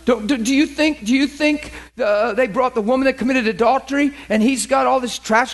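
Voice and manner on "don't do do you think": ordinary delivery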